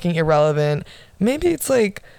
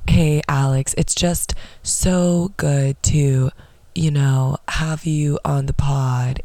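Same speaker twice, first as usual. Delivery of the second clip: lower, slow, put-on NPR-style host voice; mock podcast voice; 4 semitones lower, slower than usual. Mocking a podcaster welcoming her guest, lower and slow. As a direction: drag it out like a try-hard radio host. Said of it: "a podcast voice".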